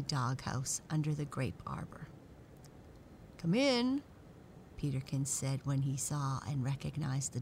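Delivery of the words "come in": high, loud call of welcome; welcoming dog character. A narrator's reading. Peterkins the dog greeting the dolls, high welcoming call. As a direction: call out high to invite them in.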